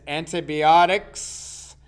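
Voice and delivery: antibiotics drawn out slowly and loud; stress on antibiotics. Real, not acted. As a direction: lean hard and long on "antibiotics". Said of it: "I emphasize that word in my list".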